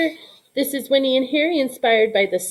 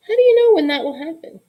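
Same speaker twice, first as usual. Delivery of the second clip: higher, louder, fast curious question; eager child; 7 semitones higher, 5 dB louder, faster than usual. Acted. Little Cole eagerly asking his mother, high and quick. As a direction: blurt it out like an eager little boy.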